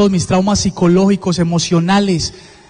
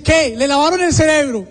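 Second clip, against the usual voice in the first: reproche incrédulo; 5 semitones higher than usual. Retold or imitated. Repite el regaño de la madre.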